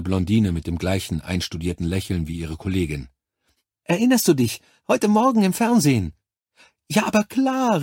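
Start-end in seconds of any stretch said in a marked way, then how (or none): 3.8-6.1 high, loud, gushing nurse; excitedly jog a colleague's memory; aufgeregt. A narrator's reading. Nurse excitedly reminds her colleague. 6.9-7.8 high-pitched delighted cry; begeisterter Ausruf. A narrator's reading. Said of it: "rief die Blondine".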